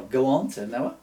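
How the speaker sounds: usual speaking voice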